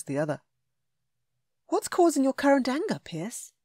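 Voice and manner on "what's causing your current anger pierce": higher, curious woman's voice; heroine voice. A narrator's reading. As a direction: ask gently, a little teasing. Heroine asks.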